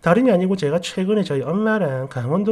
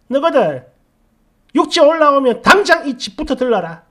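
loud, higher-pitched imitation of an order; acted-out imperious command, raised voice; demanding mother-in-law; 6 semitones higher, 6 dB louder than usual. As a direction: mimic her laying down the law. Quoting demanding mother-in-law's order.

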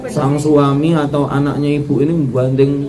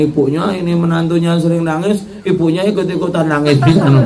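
usual speaking voice

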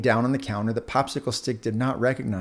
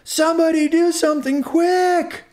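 much higher, loud, slow shriek; frantic scream; 6 dB louder than usual. Acted. Right Earbud screams for rescue, terrified. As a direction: scream for anyone to help. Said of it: "screamed Right Earbud".

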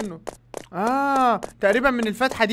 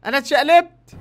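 high, loud startled cries; startled yelling; 6 semitones higher, 6 dB louder than usual. Real, not acted. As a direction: cry out as you flip. Flipped over in game.

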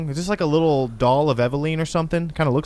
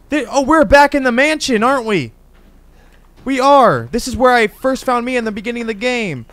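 loud, high burst of realization; startled recognition; 8 semitones higher, 7 dB louder than usual. Real, not acted. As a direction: raise pitch and volume as it dawns on you. Recognizes the mansion from earlier.

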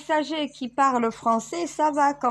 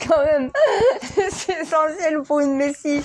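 higher, giggling through the words; laughing; 4 semitones higher than usual. Real, not acted. Boasting about writing the prophets' names, laughing. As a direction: crack up between phrases.

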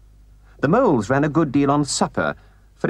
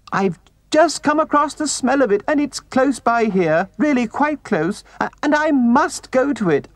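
very high, desperate little mole voice; high-pitched, distraught begging; anguished pleading; 4 dB louder than usual. A narrator's reading. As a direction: plead frantically, stumbling over words. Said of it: "pleaded the poor mole in anguish".

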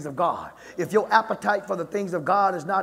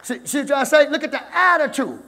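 high, loud, driving sermon voice; full-force preaching; 7 semitones higher, 7 dB louder than usual. Real, not acted. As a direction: drive it at the congregation in rising waves.

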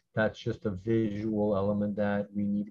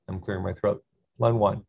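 ordinary talk, nothing marked

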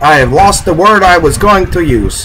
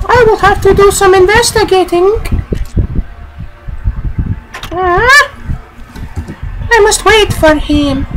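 lightly hammed-up hero. Mock formal declaration.

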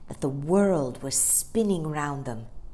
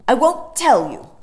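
Michael's voice; 7 semitones higher, 12 dB louder than usual. A narrator's reading. Michael teases his sister.

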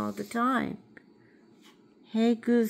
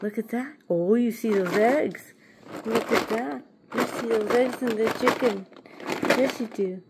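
ordinary talk, nothing marked

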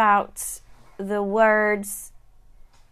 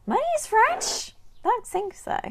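surprised. Real, not acted. Teacher surprised at viewer's guess.

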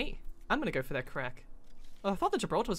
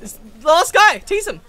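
excited shout; 7 semitones higher, 14 dB louder, slower than usual. Real, not acted. Excited fight call.

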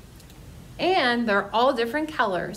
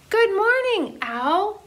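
cheerful shout; 9 semitones higher than usual. Acted. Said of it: "they all shouted".

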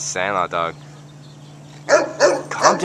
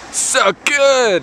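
so good drawn out higher, mock blissful; mock savoring; 8 semitones higher, 3 dB louder than usual. Joking about tacos, drawing out so good in mock bliss. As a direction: savor it like the best food ever. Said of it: "why we're talking like that".

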